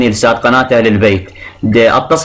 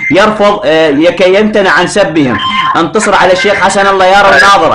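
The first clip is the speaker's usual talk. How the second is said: heated arguing; 6 semitones higher than usual. Real, not acted. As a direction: push your point over him. Caller argues heatedly with sheikh.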